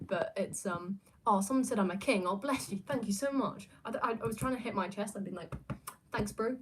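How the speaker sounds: ordinary talk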